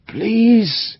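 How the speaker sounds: desperate high begging please. A narrator's reading. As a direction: beg one last time, breaking. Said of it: "Lord John spoke in French".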